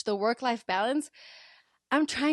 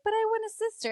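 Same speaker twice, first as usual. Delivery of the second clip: put-on child's plea; 11 semitones higher, faster than usual. Daughter quoted asking for sister.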